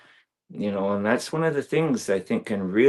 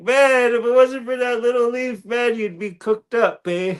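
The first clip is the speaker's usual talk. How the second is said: high, loud, playful talking-back voice; mock leaf talking; 14 semitones higher, 4 dB louder than usual. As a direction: tease the dancer as the leaf. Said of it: "it's like".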